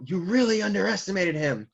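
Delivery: high-pitched mild mock yell; retold yell. Recapper as Big Mom to Kaido, mildly yelling. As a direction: call it out high, as a reproach. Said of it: "Big Mom yelling out".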